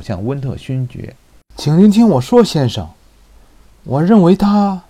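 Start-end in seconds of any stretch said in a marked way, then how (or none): 1.6-4.8 urgent pleading. Acted.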